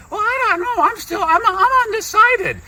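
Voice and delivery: very high, squeaky puppet-like reply; high-pitched comic interviewee; test guest voice. Acted. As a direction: answer in a silly falsetto. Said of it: "this is my test guest".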